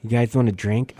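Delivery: ordinary delivery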